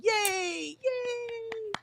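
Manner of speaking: high, stretched-out yay; drawn-out cheer. Real, not acted. Streamer cheering a viewer's afternoon sprint, high drawn-out cheer.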